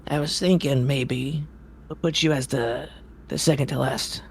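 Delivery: a gruff, impatient circus ringmaster scolding. Acted. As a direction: grumble at the late performer. Ringmaster reads schedule.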